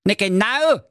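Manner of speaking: high-pitched comic fury; mock angry yell. Jakob agrees someone botched it, high mock-angry yell. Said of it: "brüllte der Jakob Grimm wütend".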